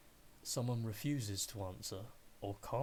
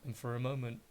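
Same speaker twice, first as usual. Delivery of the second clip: normal talking voice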